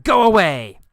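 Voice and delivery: high, loud yell; angry dismissive shout. Acted. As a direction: shout it out, pitch falling hard. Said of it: "I yelled at him".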